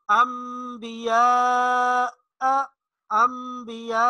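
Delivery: अंबिया said slowly with nasal ghunna on म; iqlab with ghunna. Shown as a demonstration. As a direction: draw out अंबिया with the nasal hum. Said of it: "जैसे उसकी मिसाल देखिए". (अंबिया को गुन्ना के साथ धीरे पढ़ें)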